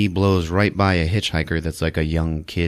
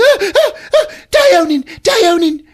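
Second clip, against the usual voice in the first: shrill, much higher, louder panicked cry; hysterical cry; 6 dB louder than usual. Retold or imitated. Eve crying out to Hugh Grant, hammed-up hysterical cry. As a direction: wail his name in terror. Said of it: "She's like".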